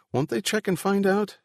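high-pitched worried asking; nervous question. A narrator's reading. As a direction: ask anxiously, voice lifted high. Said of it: "looking nervous".

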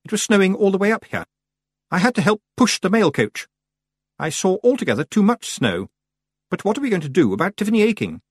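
high-pitched, concerned witch; high, anxious character voice; fretful Miss Tick. Acted. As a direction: raise the voice, worried and proper.

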